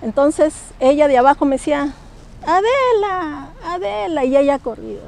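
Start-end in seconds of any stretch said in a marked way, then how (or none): 2.4-4.2 high, very slow, drawn-out name; ghostly call. Retold or imitated. Dead friend calling up to the balcony, slow and drawn out.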